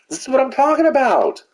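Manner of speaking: very high-pitched outburst of frustration; high, strained gamer yell; exasperated yell. Real, not acted. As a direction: cry out in real exasperation, pitch leaping way up. Exasperated outburst after dying in game.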